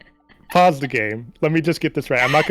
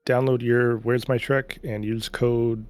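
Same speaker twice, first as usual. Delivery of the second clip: usual speaking voice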